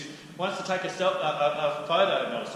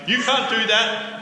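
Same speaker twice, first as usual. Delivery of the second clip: louder, higher, slowed and emphatic; indignant protest; 8 semitones higher, 10 dB louder, slower than usual. Retold or imitated. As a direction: object with outrage. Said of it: "now they're saying".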